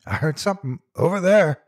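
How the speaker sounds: high, loud call across the hills; distant call. A narrator's reading. Searcher calling to his partners, loud call. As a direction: shout back to the other searchers. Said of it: "one of the distant voices called".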